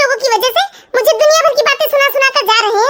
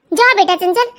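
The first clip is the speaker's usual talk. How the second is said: ordinary talk, nothing marked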